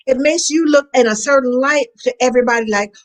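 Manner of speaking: ordinary talk, nothing marked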